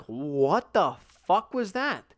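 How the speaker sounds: slow, drawn-out disbelief; stunned reaction. Retold or imitated. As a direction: retell a stunned reaction, dragging the words. Startled reaction to flying figure.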